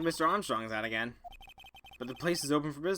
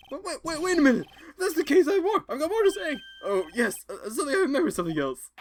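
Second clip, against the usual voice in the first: high, loud, frantic witness blurting out; 12 semitones higher, 7 dB louder than usual. Acted. Flustered witness begging the judge to hear more, high and loud. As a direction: jump in high and panicky, desperate to keep talking. Defendant voiced bursting out.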